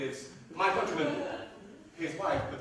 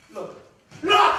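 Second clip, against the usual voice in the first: sudden, much higher and louder; a burst of delight; Aaron delighted by the baby; excited cry; 14 dB louder than usual. Acted. Aaron points at the smiling baby.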